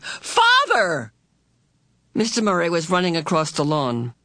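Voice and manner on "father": very high, astonished cry; sudden yell. A narrator's reading. Son suddenly seeing his father, yelling. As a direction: yell it high, falling away at the end. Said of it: "Sandy suddenly yelled".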